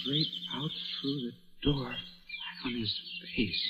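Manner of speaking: hushed, slowing shock; stunned hush. Acted. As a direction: breathe it out in disbelief. Body falls, Ron stunned.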